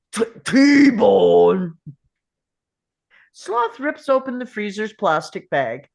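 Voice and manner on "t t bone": loud stammering monster-man shout; Sloth's excited cry. Acted. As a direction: blurt it out with greedy joy. Sloth finds steak in freezer.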